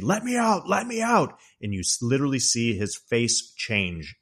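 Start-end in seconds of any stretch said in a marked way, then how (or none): 0.0-1.4 much higher, hammed-up demand; trapped husband yelling at his wife; mock yell. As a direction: pound the door and demand out. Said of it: "He initially is yelling at her".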